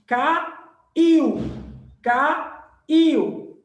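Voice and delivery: caiu said loud and slow as one smooth syllable glide; teacher stretching caiu so the iu stays joined; diphthong demo. Shown as a demonstration. Teacher showing students the diphthong, caiu loud and slow in one glide. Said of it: "ele é pronunciado junto".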